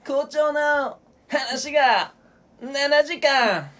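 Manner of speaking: high, very slow singer impression; drawn-out, affected rock-singer delivery. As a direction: stretch each phrase out in a high put-on voice. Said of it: "ミスターチルノランの[name]です".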